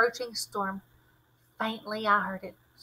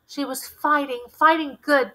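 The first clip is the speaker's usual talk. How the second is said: shaking voice; 4 semitones higher, 7 dB louder than usual. A narrator's reading. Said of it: "his voice shaking".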